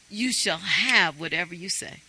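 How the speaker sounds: normal talking voice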